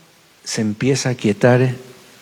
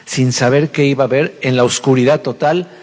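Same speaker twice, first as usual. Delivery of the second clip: ordinary talk, nothing marked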